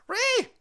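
very high, loud "Whee!" squeal. Real, not acted. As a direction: let out a gleeful squeak. Repeated squeal.

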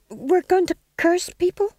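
high, hesitant answer rising at the end; tentative venture. A narrator's reading. Second witch to stage manager, venturing nervously. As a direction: offer it unsurely, like a question. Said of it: "he ventured".

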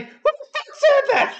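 very high mock challenge; high put-on voice, half laughing; hammed-up squeaky outburst; startled cartoon cat. Acting the startled cat's reply.

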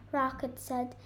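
ordinary delivery

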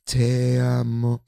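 drawn out like a soap star; mock romantic. [name] joking to Thomas, slow mock-romantic declaration.

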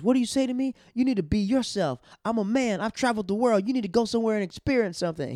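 indignant mock rant, voice pushed up; pissed-off comeback. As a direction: imitate an angry comic, high and loud. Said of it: "it pisses him off".

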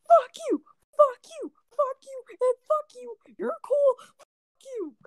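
very high falsetto cursing fit; mad outburst. Retold or imitated. Retelling a friend losing it at everyone, mock furious squeal. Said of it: "he loses his shit".

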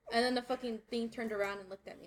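ordinary talk

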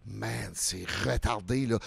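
low slow mock disbelief; low, slow mutter. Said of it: "je fais".

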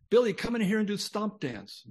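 high, loud call across the living room. Retold or imitated. Father calling boy to dance.

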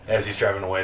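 usual speaking voice

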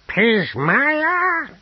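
high, loud, stretched exclamation; astonished greeting. Acted. Apothecary recognises Pismire behind him, astonished. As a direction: cry out the name in amazement.

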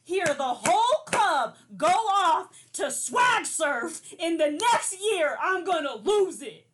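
loud, slow, high exclaim; loud excited. Real, not acted. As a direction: shout it like you can't wait. Longing for the club.

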